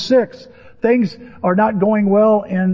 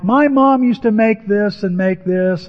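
slow, drawn-out mock whine, pitched up; whining husband; 4 semitones higher, slower than usual. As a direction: whine like a husband comparing his wife's cooking. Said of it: "a whining husband talking to him".

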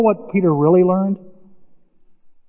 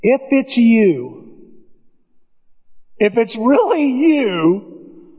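very slow, drawn-out Peter; slow doubtful Peter; halting Peter; 4 semitones higher, much slower than usual. Retold or imitated. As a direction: hesitate on every word. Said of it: "what does Peter say".

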